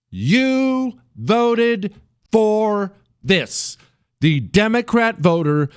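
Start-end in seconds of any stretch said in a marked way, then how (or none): 0.1-3.8 higher, each word drawn out very slowly; a cold, final verdict, word by word; "You" stressed, the rest slow and final; slow, emphatic retort. Shown as a demonstration. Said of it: "You know what you say to 'em?".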